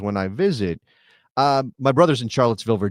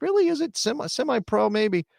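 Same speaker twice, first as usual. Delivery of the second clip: skeptical; 9 semitones higher than usual. Retold or imitated. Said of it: "I was like".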